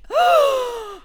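high, loud theatrical gasp; hammed-up sharp intake of breath; mock gasp. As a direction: gasp as a scandalised lady. Retold shocked heroine gasp again.

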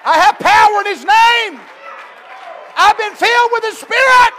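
rising to a loud, high preaching shout; a preacher defying the devil, climbing to a shout; preaching at full force. Acted.

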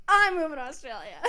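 thrilled laughing exclaim; excited, laughing. Retold or imitated. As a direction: blurt it out, delighted at the coincidence. Surprised to share the move.

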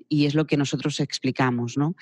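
ordinary talk

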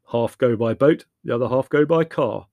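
loud, decisive instructions; firm, practical brother; blunt brother. Acted. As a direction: lay out the plan loudly. Voices Leslie.

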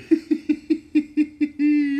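loud, high real laugh; cracking up at the clip; high burst of laughter. Real, not acted. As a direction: break out laughing hard. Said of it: "I'm laughing".

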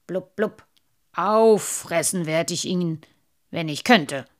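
sulking, spitting out blubs; grumpy old carp. Acted. Indignant carp rants.

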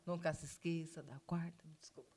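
soft unvoiced whisper. Real, not acted. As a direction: whisper it as an aside. Aside, whispered.

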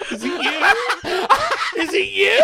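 high-pitched laughing question; teasing through laughter. Real, not acted. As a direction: tease your friend while cracking up. Teases friend while cracking up.

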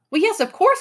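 high, eager cry; excited boy's exclamation. A narrator's reading. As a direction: burst out excitedly, pitched high. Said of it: "cried Ben excitedly".

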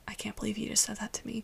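unvoiced whisper of disbelief; a shocked host whispering; silent disbelief. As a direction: breathe it out without voice, stunned. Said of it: "just, like, silent".

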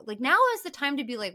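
ordinary talk

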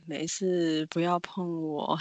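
low, very slow, distant mumble; eerie dazed voice. Retold or imitated. Possessed classmate answering worried girls, dazed and slow. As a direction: sound like someone not quite there.

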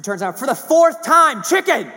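wife yelling the answer for the fourth time; exasperated shout. Retold or imitated. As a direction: snap the punchline back at him. Wife's exasperated punchline.

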